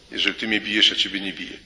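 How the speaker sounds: ordinary talk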